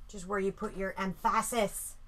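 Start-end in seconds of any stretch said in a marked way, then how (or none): 1.0-1.9 "emphasis" stressed on the second syllable; misplaced stress. Shown as a demonstration. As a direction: joke mispronunciation, em-FASS-is for "emphasis". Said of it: "emphasis on the wrong syllable".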